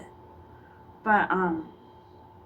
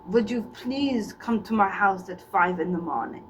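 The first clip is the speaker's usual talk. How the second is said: put-on Russian accent; 2 semitones lower than usual. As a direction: invite them over in a mock Russian accent. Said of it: "The Russian accent".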